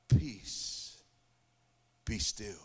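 low, hushed command, sinking on be still; calm voice of Jesus. Retold or imitated. Jesus answering the one who prayed, low and hushed. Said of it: "he says".

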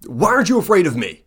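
high, fast, baffled creature question; a confused monster demands an answer; the centipede is puzzled she isn't scared; bewildered monster. Acted. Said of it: "and goes".

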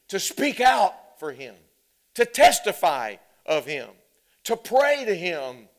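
loud, high, ringing sermon cry; full-force preaching. Acted. Pastor urging the church to proclaim boldly, full-force preaching. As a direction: preach it to the rafters with boldness.

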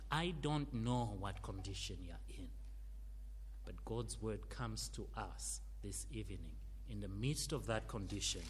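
usual speaking voice

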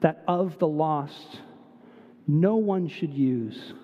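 solemn recitation of Barrett Browning, softening to the end; poem recitation. Acted. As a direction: read the verse solemnly, letting it fade.

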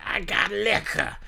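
put-on high, slow drunk vendor call; drunk vendor imitation. Drunk vendor yelling at fans, high and slow. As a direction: drag it out like the drunk hawker. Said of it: "He's like".

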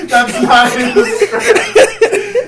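loud, high, slow, laughing protest; shrieking with laughter. Real, not acted. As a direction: howl it out through laughter.

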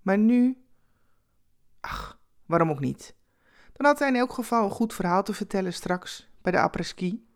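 plain reading voice

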